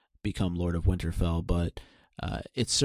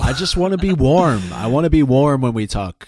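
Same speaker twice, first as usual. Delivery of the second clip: loud, whiny begging; whiny plea; 6 semitones higher, 10 dB louder than usual. Pretend. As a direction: whine to be warm, loudly. Cold host pleads for warmth.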